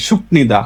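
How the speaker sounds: সুপনিদা with p kept before n; beginner's pronunciation. Retold or imitated. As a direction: imitate a new learner saying সুপনিদা with p. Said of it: "নতুন নতুন Korean শিখে সবাই বলে". (নতুন শিক্ষার্থীর মতো সুপনিদা, প দিয়ে)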